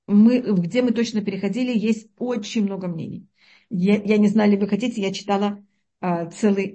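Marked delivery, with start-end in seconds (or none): none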